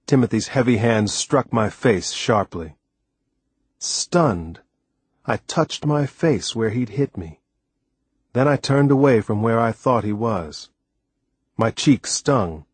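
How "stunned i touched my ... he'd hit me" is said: ordinary delivery, nothing marked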